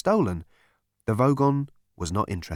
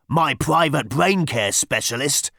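Vogon voice; 4 dB louder, slower than usual. A narrator's reading. Vogon quoted by narrator.

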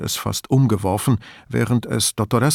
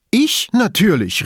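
high, louder indignant cry; entrüstet; 11 semitones higher, 5 dB louder than usual. A narrator's reading. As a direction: throw it back, affronted. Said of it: "rief sie entrüstet". (entrüstet ausgerufen)

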